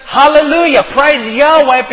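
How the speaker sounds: high, loud shouted Hallelujah. Acted. Saints in heaven praising God, shouting loud. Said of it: "to shout hallelujah".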